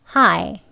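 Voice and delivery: 하이 ending in 이 with 에 jaw opening; correct hi. Shown as a demonstration. Teacher shows the correct 하이, careful demo. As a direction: say 하이 with the jaw open. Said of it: "이와 에 소리 같이 날 수 있게".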